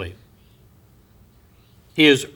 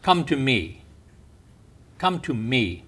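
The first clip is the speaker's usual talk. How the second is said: soft, tender call, lower and much softer; gentle invitation; 4 semitones lower, 8 dB quieter than usual. Acted. Preacher voicing Jesus inviting people in, soft and tender. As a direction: softly beckon them to come.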